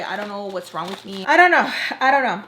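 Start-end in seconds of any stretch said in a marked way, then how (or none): 1.3-1.7 sudden high, loud burst; exasperated outburst. Real, not acted. Storyteller wondering what's wrong with her, loud exasperated outburst. 2.0-2.4 louder frustrated repeat, less high; milder exasperation. Real, not acted. Storyteller wondering what's wrong with her, easing loud exasperation.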